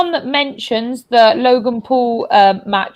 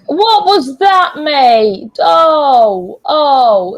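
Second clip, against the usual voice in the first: pained, raised cry of disbelief; exasperated outcry; 3 semitones higher, 5 dB louder, much slower than usual. Real, not acted. Fan to player after a blunder, exasperated outcry. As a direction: groan out your outrage at the mistake.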